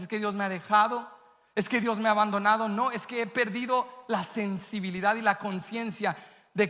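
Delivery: high, loud, half-sung preaching; preaching at full force. Acted. Pastor preaching to the church at full force, high and loud. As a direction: lift the church up and pour it out.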